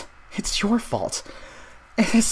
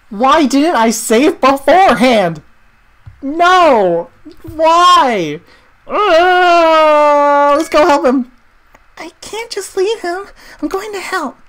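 panicked outcry; 8 semitones higher, 8 dB louder, slower than usual. Real, not acted. Panics as teammate gets caught.

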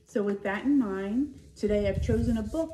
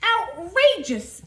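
outraged crowd; 10 semitones higher, 8 dB louder, slower than usual. A narrator's reading. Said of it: "everyone said".

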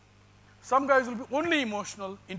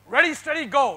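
loud, high starter's shout; race starter call; 6 semitones higher, 10 dB louder than usual. Acted. Lecturer mimics a race start for trainees: loud starter shout.

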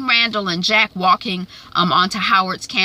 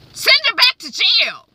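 indignant demand; 13 semitones higher than usual. Acted. Randall demanding angrily.

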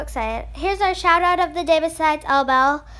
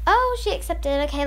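ordinary delivery

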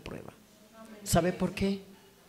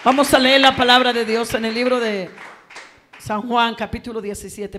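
loud, high, fervent sermon voice; preaching at full force; 5 semitones higher, 13 dB louder than usual. Acted. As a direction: preach it out to the whole church. Predica a toda voz. (predicación a toda voz, fervorosa)

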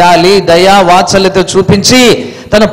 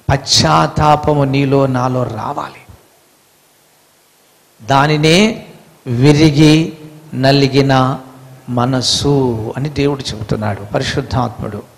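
solemn hush; 9 semitones lower, 8 dB quieter than usual. Acted. Preacher drops to solemn hush.

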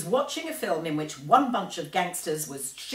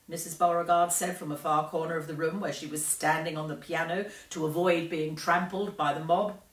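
ordinary delivery

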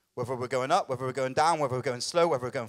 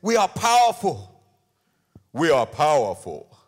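hammed-up, drawn-out triumphant shout; booming declaration; 9 dB louder than usual. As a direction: proclaim it grandly to a crowd. Mimics a preacher declaring it.

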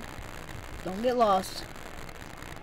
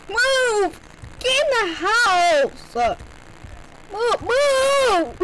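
shouting; 9 semitones higher, much slower than usual. Real, not acted. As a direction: bark orders at the screen. Shouting at character.

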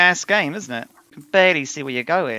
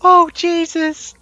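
shaken yell; 14 semitones higher, 7 dB louder, much slower than usual. Real, not acted. Just escaped the monster.

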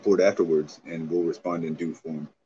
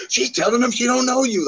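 mocking voice; 5 dB louder than usual. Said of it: "And then they're like".

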